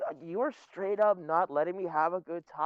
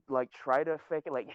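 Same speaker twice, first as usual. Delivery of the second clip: ordinary talk, nothing marked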